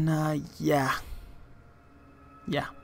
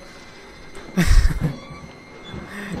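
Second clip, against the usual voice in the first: nervous breathy laugh; 2 semitones higher, 4 dB louder than usual. Real, not acted. Nervous laugh after scare.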